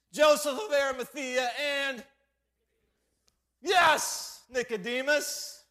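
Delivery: high, loud sermon delivery; emphatic pulpit storytelling; full-force preaching. Real, not acted.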